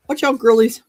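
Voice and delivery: loud call to the dogs again. Real, not acted. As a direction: call out, playful.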